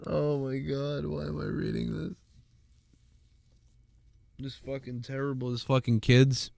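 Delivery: hushed, half-laughing, close to tears; fighting back tears after a sad story; choked up. Real, not acted. Streamer moved by a dying graduate's story, hushed and choked up. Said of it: "I'm really fucking upset by this".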